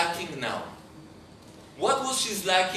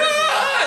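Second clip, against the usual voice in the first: very high, loud mock shriek; imitated child's scream; 9 dB louder than usual. Little girl as the needle goes in, imitated shriek. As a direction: squeal like a terrified little girl.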